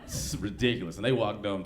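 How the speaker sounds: usual speaking voice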